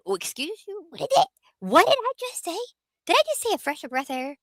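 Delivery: mock horror at your own slip; shocked at herself. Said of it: "I was like".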